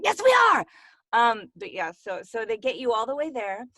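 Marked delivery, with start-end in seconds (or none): 0.0-0.6 bright, loud mock insistence; playful shout. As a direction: shout it back cheekily.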